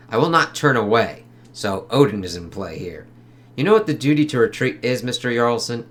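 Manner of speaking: a hint of a tough detective; reading comic dialogue. Reads interrogation comic dialogue.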